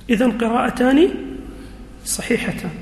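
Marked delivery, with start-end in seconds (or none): none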